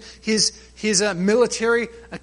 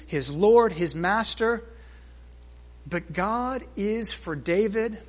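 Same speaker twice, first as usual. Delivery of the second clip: ordinary talk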